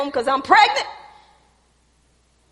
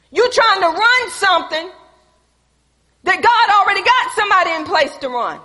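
loud, high, emphatic sermon delivery; full-force preaching; 6 semitones higher, 8 dB louder than usual. Real, not acted. Preacher correcting those who think they're in charge, loud and forceful. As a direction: drive each phrase home to the congregation.

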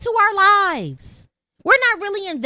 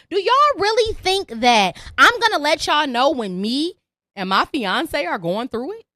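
normal talking voice

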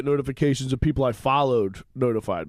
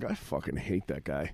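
low, slow, quiet seething; retold simmering grudge; 13 semitones lower, 13 dB quieter than usual. Comic recalling a heckler three days later, seething quietly. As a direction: let the delayed resentment out under the voice. Retelling a delayed private grudge.

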